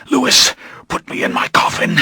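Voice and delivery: breathless whispered plea; pleading whisper. Acted. Dying vampire whispers his plea to Louis. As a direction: beg it under your breath. Poisoned Lestat begs, then shouts.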